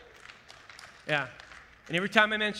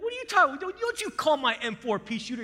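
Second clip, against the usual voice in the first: playfully affronted comeback; indignant protest; 7 semitones higher than usual. Retold or imitated. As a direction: act offended that anyone dared insult it. Acting out offended reply about his rifle.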